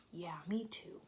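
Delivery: soft whispered agreement. Real, not acted. Teacher whispering agreement to children. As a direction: whisper it back.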